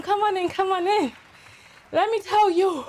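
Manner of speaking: normal talking voice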